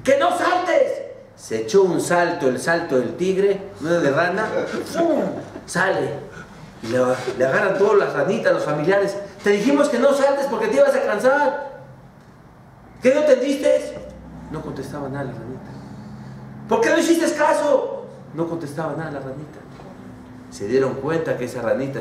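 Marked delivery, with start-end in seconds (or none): 0.0-1.0 high shouted command; frogs yelling. Acted. Relatives shout as the frog jumps harder, high yell. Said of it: "saltaba más duro". 9.4-14.0 high scolding voice; scolding relatives. Acted. Relatives scold the frog out of the well, high. 16.7-18.1 high scolding voice; scolding relatives. Acted. Relatives scold the frog out of the well, high.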